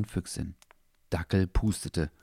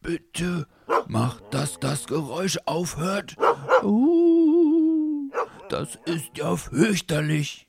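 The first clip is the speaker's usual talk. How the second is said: high, squeaky, scared little voice; frightened squeak; 10 semitones higher, much slower than usual. Acted. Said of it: "Leo quiekte verschreckt".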